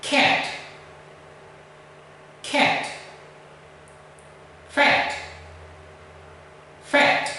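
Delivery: "Can't" and "Fat" with the American vowel, slow and soft; American vowel demo. Shown as a demonstration. Teacher modelling words for Greek students, careful American vowels. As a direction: model each word twice, carefully. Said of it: "let me pronounce these two words".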